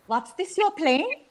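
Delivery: high, slow probing question; suspicious auntie. A narrator's reading. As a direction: quiz the child, sweetly suspicious. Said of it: "as if she were examining raw meat".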